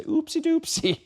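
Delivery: put-on very high, silly toddler-show squeak; baby voice. Shown as a demonstration. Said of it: "she didn't like my baby voices".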